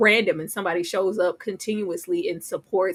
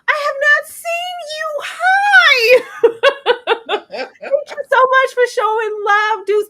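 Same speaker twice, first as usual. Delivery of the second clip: excited squeal; 8 dB louder, slower than usual. Real, not acted. Excited greeting to a viewer.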